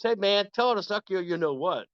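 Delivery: high, sly buddy voice; egging on. Retold or imitated. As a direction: nudge him on with a grin. Bass player egging him on.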